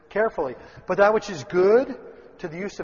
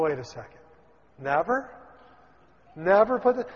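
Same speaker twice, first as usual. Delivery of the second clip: ordinary talk, nothing marked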